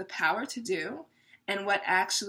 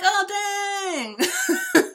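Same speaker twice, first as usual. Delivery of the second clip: high, laughing, caught-off-guard gasp; mock-wounded surprise, pitched way up; stunned oh dang; 11 semitones higher, 6 dB louder than usual. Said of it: "And you're like".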